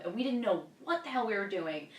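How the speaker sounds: usual speaking voice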